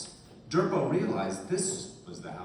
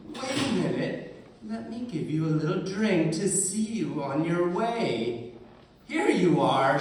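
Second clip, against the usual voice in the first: witch offering drink; 5 semitones higher, 5 dB louder than usual. A narrator's reading. Witch stops departing travellers.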